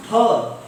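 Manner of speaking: "ph" as a loud p with a burst of air; aspirated p. Shown as a demonstration. Teacher demonstrating plosives, aspirated p. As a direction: pop the lips open on "ph". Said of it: "Ph with pressure".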